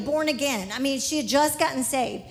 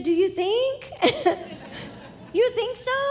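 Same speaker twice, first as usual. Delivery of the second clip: disbelieving laugh; 8 semitones higher than usual. Retold or imitated. Stunned lady hopes for healing.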